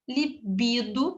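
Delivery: slow "Libido" with middle syllable BI stressed. Shown as a demonstration. Teacher modelling the correct word, "Libido" slow and stressed on BI. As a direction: stretch out "Libido", leaning on BI. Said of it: "a sílaba tônica é no bi".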